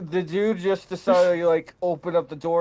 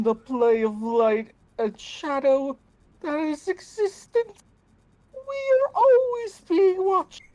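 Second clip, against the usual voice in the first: pitching up; 10 semitones higher than usual. Acted. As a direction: pitch climbing far higher each phrase. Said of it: "you're pitching up and pitching up".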